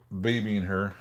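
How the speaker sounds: ordinary delivery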